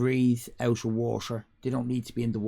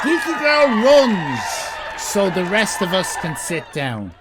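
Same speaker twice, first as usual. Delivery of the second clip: high, loud announcer praise; grand proclamation; 7 semitones higher, 8 dB louder than usual. Real, not acted. Streamer proclaiming a viewer's praise, loud and high. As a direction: declare it like a herald.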